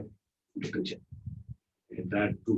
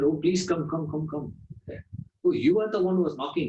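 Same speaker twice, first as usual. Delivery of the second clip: eager welcome; 6 semitones higher than usual. Retold or imitated. Doorkeeper greeting the prince.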